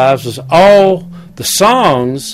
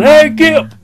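higher, louder call across the yard; yelling; 11 semitones higher, 4 dB louder than usual. Retold or imitated. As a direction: call out loud to Gibb up the driveway. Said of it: "Johnny's yelling".

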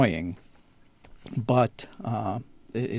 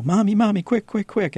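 put-on high, urgent little kid calling; a child pleading for mom's attention right now; mock kid whining for his mommy; imitating a little kid; 9 semitones higher, 3 dB louder than usual. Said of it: "like the little kid who goes".

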